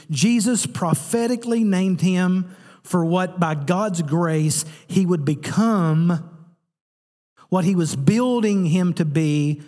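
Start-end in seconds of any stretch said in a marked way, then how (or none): 4.9-9.6 preaching climax. Real, not acted. Preacher rising to a shout on what God builds him to be.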